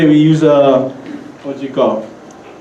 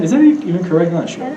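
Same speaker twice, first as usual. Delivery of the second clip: ordinary talk, nothing marked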